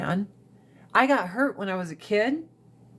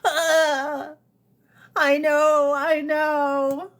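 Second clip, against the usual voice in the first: higher, whiny fake cry; mock whimper; 6 semitones higher, much slower than usual. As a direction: whimper like a scolded kid. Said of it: "It's like".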